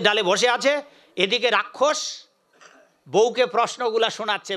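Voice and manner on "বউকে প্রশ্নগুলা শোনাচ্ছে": ordinary delivery, nothing marked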